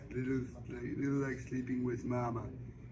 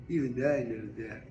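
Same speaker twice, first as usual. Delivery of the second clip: usual speaking voice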